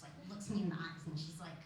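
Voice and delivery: normal talking voice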